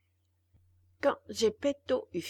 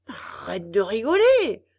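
loud, sharp scolding shout; 12 dB louder than usual. A narrator's reading. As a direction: scold the laughing puppet. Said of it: "gronda Geppetto".

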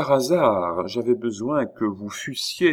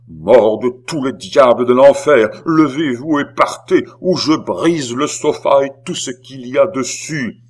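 very loud, raised, threatening bellow; furious shout; 4 semitones higher, 9 dB louder than usual. A narrator's reading. Beausire threatens to smash the sofa, furious shout. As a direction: roar the curse and the threat.

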